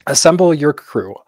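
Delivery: ordinary talk, nothing marked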